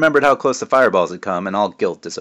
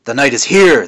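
loud, high witch alarm; hissed warning; 4 semitones higher, 9 dB louder than usual. A narrator's reading. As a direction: spit it out at him.

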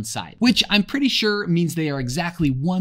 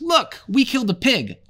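excited boast; 11 semitones higher, 5 dB louder than usual. A narrator's reading. Boy boasting of the kill.

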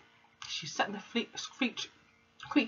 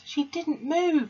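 put-on high, slow children's protest; lightly voiced schoolchildren crying out; class crying out; 11 semitones higher, 3 dB louder, slower than usual. A narrator's reading. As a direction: plead together like a class. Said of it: "they cried out".

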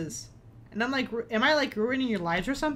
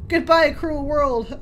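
melodramatic; 3 semitones higher, 6 dB louder, slower than usual. Acted. Dramatic dying moment.

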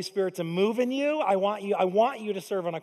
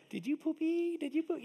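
put-on high cooing baby talk to a toddler; sing-song parent voice cooing over a diaper; 10 semitones higher, 6 dB quieter than usual. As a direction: coo it like a proud parent.

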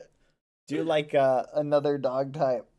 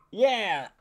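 usual speaking voice

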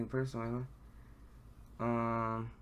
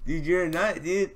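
excited; 5 semitones higher, 6 dB louder than usual. Real, not acted. Gushing at idol.

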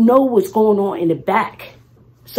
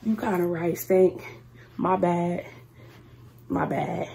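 soft, low, conceding to her friend; sheepish quiet apology; 4 semitones lower, 11 dB quieter than usual. Retold or imitated. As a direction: mumble it like you got caught. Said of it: "I was like".

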